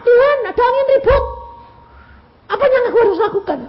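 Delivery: very high, fast, loud cry; acted rapid-fire shout to God. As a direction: yell a frantic prayer for help. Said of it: "Kamu teriak".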